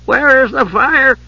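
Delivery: put-on slow, high cracked old voice. A narrator's reading. Elderly monk peering into the dark, demanding in a cracked voice. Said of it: "demanded a cracked voice".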